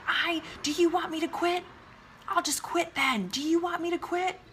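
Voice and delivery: high, strained mock yell; mock yelling at husband. Wife mimicking her past yelling at husband, mock yell. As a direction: act out your old angry self. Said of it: "I used to yell".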